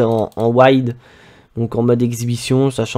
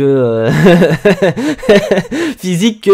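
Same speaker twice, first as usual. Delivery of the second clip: laughing; 5 semitones higher than usual. Real, not acted. Laughs mid-sentence.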